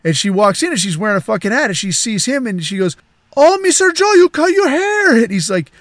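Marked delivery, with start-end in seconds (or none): 3.3-5.2 excited housekeeper. Retold or imitated. Housekeeper greeting her boss's haircut, high excited voice. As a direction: exclaim it high and loud as the amazed housekeeper.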